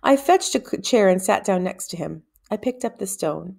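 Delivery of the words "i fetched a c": usual speaking voice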